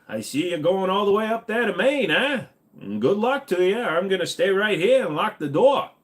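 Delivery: high, swooping Maine local voice; horror-movie gas station attendant. Acted. Attendant warning doomed kids headed to Maine, high put-on local voice. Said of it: "like a gas station attendant in a horror movie".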